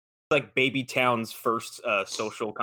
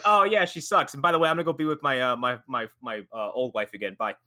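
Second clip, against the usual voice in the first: dismissive mockery. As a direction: toss it off carelessly. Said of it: "Now it's like".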